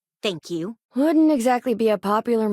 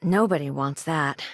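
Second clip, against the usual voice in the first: normal talking voice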